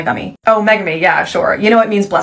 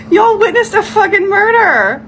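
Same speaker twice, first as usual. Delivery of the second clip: high-pitched mock-horrified cry; shocked outcry; 12 semitones higher, 4 dB louder than usual. Telling viewers they witnessed a worm murder, shocked outcry. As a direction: cry out as if you saw a killing.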